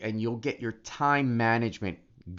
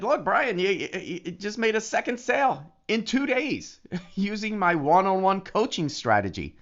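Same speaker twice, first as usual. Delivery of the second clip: quoting the coach; 8 semitones higher than usual. Retold or imitated. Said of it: "he said".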